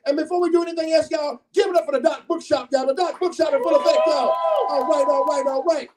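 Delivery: high, fast, hyped shout-out; hype shout-out. Real, not acted. MC shouting out the Doc Bookshop to the room, hype shout-out. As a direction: hype the sponsor to the crowd.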